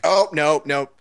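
acted alarm. Fan watching a jiu-jitsu move slip, mock alarm. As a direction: blurt it like an invested spectator.

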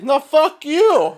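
put-on high, loud, slow Indian-accented retort; imitated Indian accent. Scammer trading insults with caller, yelled in an Indian accent. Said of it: "in his Indian accent or whatever".

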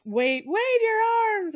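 high-pitched mock shout; calling out. Staff prompting the banshee in the swing, high mock call. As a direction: call up an urgent instruction, playfully.

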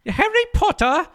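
high-pitched put-on British author; trying Rowling voice. Trying out J K Rowling before the skit, high British voice.

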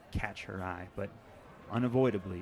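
normal talking voice

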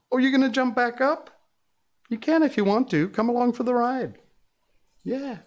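high doting voice for the dog; delighted coaxing of the pet; doting dog talk. Real, not acted. Dog jumps back up.

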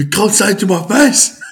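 high, loud mock outburst; imitating teammate's outburst. Retelling teammate's outburst, high loud mock yell. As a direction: ham up the teammate's angry line. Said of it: "What did he say?".

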